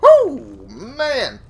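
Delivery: loud, high whoop of triumph; excited whoop. Real, not acted. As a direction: cheer a big win. Excited after a big kill.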